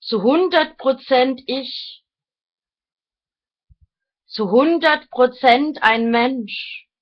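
deliberate, declaimed phrases, each set apart; reading aloud. Real, not acted. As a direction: read your written thoughts out like solemn maxims. Reads own written thoughts aloud.

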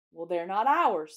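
loud but hesitant objection; doubtful. A narrator's reading. Peter questions borrowing coats that are not theirs, doubtful. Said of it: "said Peter doubtfully".

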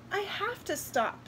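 put-on high, small little-girl voice; tired little girl. A narrator's reading. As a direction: plead as a worn-out child. Tired girl begs to stop.

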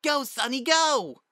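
put-on high, loud cheering kid; squeaky girl cheering at full volume; excited child's yell. A narrator's reading. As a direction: urge the runner on, yelling high. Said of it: "Spitfire yelled".

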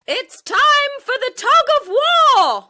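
high, loud teacher's call; teacher announcing the tug of war, loud; loud scream. A narrator's reading. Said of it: "the teacher screamed loudly".

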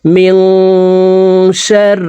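slow, drawn-out chanted recitation; Quranic recitation. Shown as a demonstration. Teacher reciting to students, slow melodic tajweed chant. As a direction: recite it melodically, stretching each vowel.

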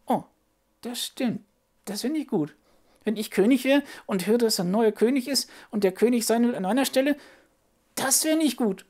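high little puppet voice working it out; picture yourself as the king; thoughtful puppet child. Acted.

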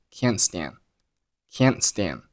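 "can't stand" without final t and d; unreleased endings. Shown as a demonstration. Teacher demonstrating "can't stand" with unreleased endings. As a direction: swallow the last sounds of "can't" and "stand". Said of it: "两个单词结尾的爆拼都没有爆出来".

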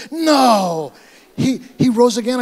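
loud, high imitation of her amazement; astonished disbelief. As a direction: act out her stunned disbelief. Retold woman's disbelief at resurrection.

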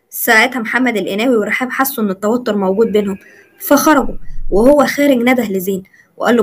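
plain reading voice

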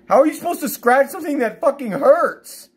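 high-pitched angry yell; real pain-fuelled rage; anguished outburst. Real, not acted. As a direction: cry out in pained frustration, voice way up. Venting about pain and itching.

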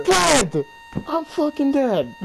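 frustrated yell. Real, not acted. Dies in the game.

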